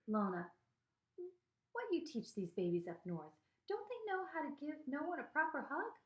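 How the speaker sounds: lightly voiced Southern granny, climbing high; tease-scold the daughter, rising to a high question; scolding grandma. A narrator's reading. Grandmother scolding mother in story.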